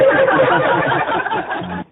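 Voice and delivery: unvoiced high laugh; breathy laughter. Real, not acted. Laughter after joke.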